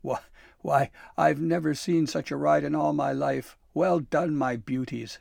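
jubilant hooting. A narrator's reading. Santa praising his reindeer after the ride, loud hooting. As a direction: hoot it out with delight. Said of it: "Santa hooted".

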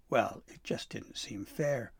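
ordinary delivery

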